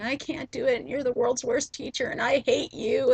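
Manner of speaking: retold child's outburst. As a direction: replay the kid yelling at his teacher, hammed-up but not a full scream. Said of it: "he kept kicking and screaming and saying".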